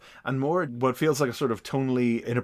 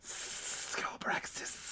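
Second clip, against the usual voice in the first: whisper; 16 dB quieter, much slower than usual. Pretend. Dramatic villain name.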